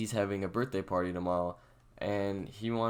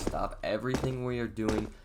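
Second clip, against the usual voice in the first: ordinary delivery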